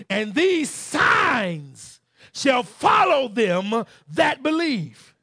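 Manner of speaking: loud, high revival preaching; fiery pulpit proclamation; preaching at full force. Acted. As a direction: thunder the scripture out to the church.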